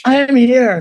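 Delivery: loud, high, grand announcement; royal proclamation. Pretend. Shepherd dog proclaiming itself, regal and loud. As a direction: boom it like a herald. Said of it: "like some royal proclamation".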